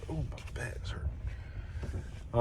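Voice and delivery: real sore-back aside; pained whisper aside. Real, not acted. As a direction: sigh out the ache. Muttered aside about back pain.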